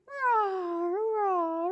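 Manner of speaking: a gentle, playful roar; mild dinosaur roar. Acted. Dinosaurs meet the kitties.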